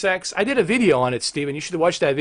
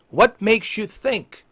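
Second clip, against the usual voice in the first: higher, deliberately slowed questioning; slow, pointed question; 6 semitones higher, slower than usual. Acted. Streamer questioning Xbox boss, slow and pointed. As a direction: spell out the question with restrained exasperation.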